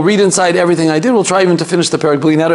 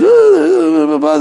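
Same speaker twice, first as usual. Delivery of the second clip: high-pitched mock wail of a scared kid; imitated child's wail; 12 semitones higher than usual. Rabbi mimics kids frightened by scary movies, high mock wail. Acting out frightened kids' reaction.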